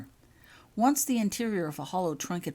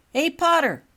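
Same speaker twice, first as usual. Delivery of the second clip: higher and louder mocking call; smirking taunt; 12 semitones higher, 11 dB louder than usual. A narrator's reading. Tom to Albus on the stairs, smirking and taunting. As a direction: jeer at him with a smirk. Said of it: "voiced Tom Richards with a smirk".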